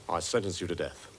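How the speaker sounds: low, unwarmed death sentence; low judge's pronouncement, first attempt; trial judge voice. Shown as a demonstration. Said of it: "I'll warm it up for you".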